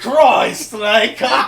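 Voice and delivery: high, loud cartoon shout; yelling boss. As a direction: bark the name like a boss. Echoing boss yell.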